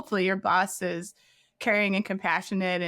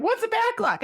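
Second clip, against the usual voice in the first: baffled question. Retold or imitated. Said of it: "And I was like".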